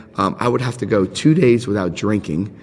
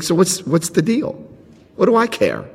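careless shrug; 7 semitones higher than usual. Retold or imitated. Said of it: "I'm like".

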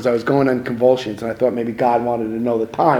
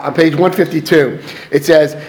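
ordinary talk, nothing marked

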